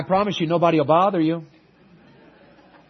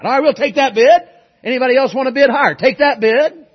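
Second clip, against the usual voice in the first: a hint of a loud auctioneer's call, louder and higher; 7 semitones higher, 9 dB louder than usual. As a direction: rattle off the bid like an auctioneer at a sale. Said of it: "the auctioneer said".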